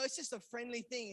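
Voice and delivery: ordinary talk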